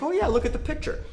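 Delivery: imitating the buyer lady. Investor imitating the buyer showing her photo, high mock innocence. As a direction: toss it off like she knows nothing. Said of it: "She's like".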